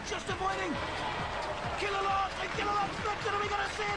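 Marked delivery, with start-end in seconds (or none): 1.8-4.0 excited. Real, not acted. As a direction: build to a shout of delight. Excited commentary on a flip.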